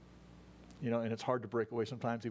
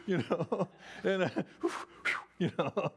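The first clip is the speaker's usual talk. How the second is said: laughing; 7 semitones higher, 4 dB louder than usual. Real, not acted. Laughs after acted quote.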